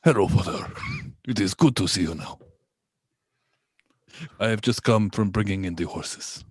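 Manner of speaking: low, put-on Spanish accent. Son greeting his long-lost father, low Spanish accent. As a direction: play a dutiful Spanish son. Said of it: "this is the accent that I should have".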